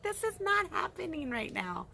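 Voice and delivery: high, amazed "not happening"; disbelief. Retold or imitated. Fans looking at each other in disbelief, high and amazed. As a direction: say it in giddy disbelief. Mother and daughter exchanging looks.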